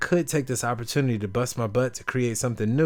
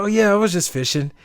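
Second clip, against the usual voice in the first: high, bright and easygoing; easygoing fisherman; 9 semitones higher, 4 dB louder than usual. Retold or imitated. Fisherman answering the tourist, breezy and casual. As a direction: shrug it off happily. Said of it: "He's like".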